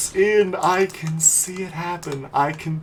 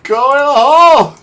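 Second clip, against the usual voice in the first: very loud, raised-pitch wail; desperate shout; 4 semitones higher, 11 dB louder than usual. Real, not acted. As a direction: bellow at the ball in desperation. Yelling at the ball.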